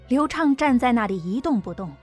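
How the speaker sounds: ordinary delivery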